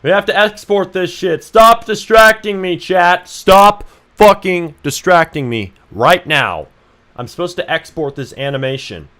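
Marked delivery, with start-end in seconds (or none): 0.0-6.7 loud, high, slow frustrated yell; exasperated high shout; shouting at chat. Real, not acted. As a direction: bellow at chat to stop distracting. Yelling at chat.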